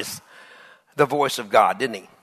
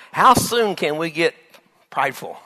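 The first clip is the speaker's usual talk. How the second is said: normal talking voice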